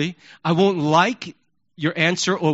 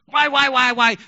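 loud, high whiny hammering why; nagging boss; 5 semitones higher, 4 dB louder than usual. Retold or imitated. Boss badgering an employee, loud and whiny. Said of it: "bosses that have done this".

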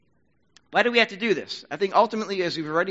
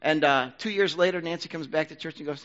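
usual speaking voice

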